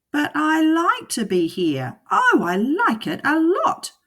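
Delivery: high, loud cheeky cat; the cat refusing to leave, teasing; cat in the hat. Acted. The cat answering the fish, high and cheeky.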